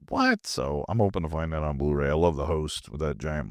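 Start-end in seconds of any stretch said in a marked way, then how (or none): 0.1-0.5 surprised. Retold or imitated. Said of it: "I'm like".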